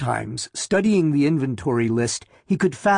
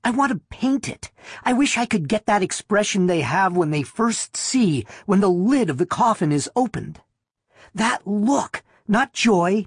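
put-on high, wondering little-girl voice; breathless, awed little girl; 7 semitones higher than usual. A narrator's reading. Wife insists on talking about the opened coffin, awed little-girl voice. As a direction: pitch it high, rapt and girlish. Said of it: "breathlessly in her little girl's awed voice".

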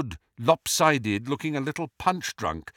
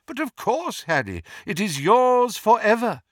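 beaming, much higher voice; Dumbledore; 10 semitones higher, 4 dB louder than usual. A narrator's reading. Headmaster granting Harry the present forever, beaming high voice. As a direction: beam with delight, swelling louder on the gift. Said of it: "and Dumbledore beamed".

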